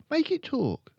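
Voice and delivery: put-on high, loud little-girl voice; high-pitched girl character; young Matilda. A narrator's reading.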